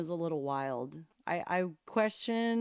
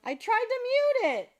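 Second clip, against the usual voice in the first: high, loud flustered apology; startled high loud excuse; 8 dB louder than usual. Real, not acted.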